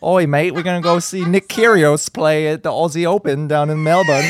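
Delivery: hammed-up Aussie bloke accent; badly faked matey Australian twang. Co-host attempts the accent for an Australian guest, bad Australian accent. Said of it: "Yours was horrible too".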